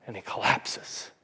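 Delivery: preacher whispering as Jesus dies; hushed whisper. Acted. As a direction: let the words fall away in a breath.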